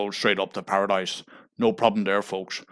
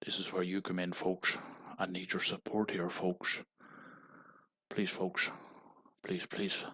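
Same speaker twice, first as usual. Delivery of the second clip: a much softer, sinking plea; hushed pleading; 13 dB quieter than usual. Acted. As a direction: drop right down and beg the audience quietly. Pleading for support, voice sinks.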